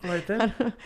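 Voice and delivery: high, chirpy mock British; British accent. Comic replying to host's tattoo, British accent. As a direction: answer like a cartoon Brit. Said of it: "the Queen's English".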